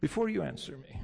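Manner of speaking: ordinary talk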